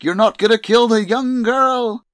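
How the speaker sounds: high, loud defiant shout; heroic shout. Retold or imitated. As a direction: shout it as you attack. Soldier attacks the hero.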